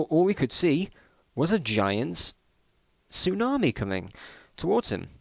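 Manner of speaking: usual speaking voice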